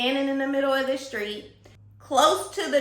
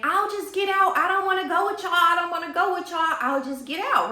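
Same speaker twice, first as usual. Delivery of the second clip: higher-pitched imitation of an upset passenger; mock insistent plea, pitched up; arguing girl; 6 semitones higher, faster than usual. Girl in back seat arguing with friends, mock insistent.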